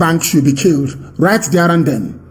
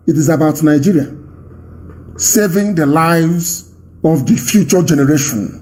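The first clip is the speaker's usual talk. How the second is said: ordinary talk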